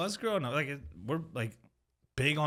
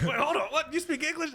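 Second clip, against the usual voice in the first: high, laughing double take; mock disbelief; 13 semitones higher than usual. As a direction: imitate a startled cop, pitch climbing. Cops startled that he speaks English.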